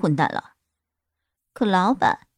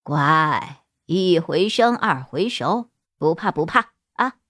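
low, gentle soothing voice; tender; 5 semitones lower, slower than usual. A narrator's reading. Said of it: "语气里充满了温柔".

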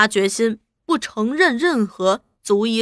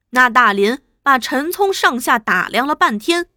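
plain reading voice

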